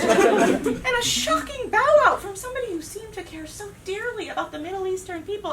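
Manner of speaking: ordinary talk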